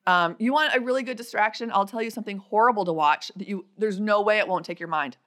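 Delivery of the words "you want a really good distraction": ordinary talk, nothing marked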